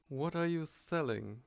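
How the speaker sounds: ordinary talk, nothing marked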